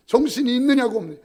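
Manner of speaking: loud, high scolding; angry rebuke. Retold or imitated. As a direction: bark it at the careless listener. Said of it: "막 화를 내요". (정신 없는 교인을 향한 높고 큰 호통)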